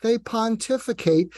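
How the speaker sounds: ordinary talk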